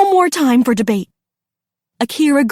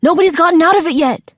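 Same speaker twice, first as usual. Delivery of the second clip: fast, high, loud alarm; urgent cry; 6 semitones higher, 5 dB louder, faster than usual. A narrator's reading. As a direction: cry it out in a rush. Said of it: "Daniel cried".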